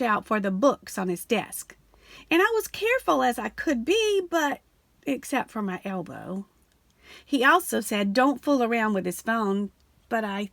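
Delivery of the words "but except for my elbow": ordinary delivery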